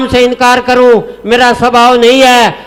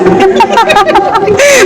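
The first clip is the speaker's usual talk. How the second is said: high laugh; 10 semitones higher than usual. Real, not acted. Comic poet laughs at own punchline.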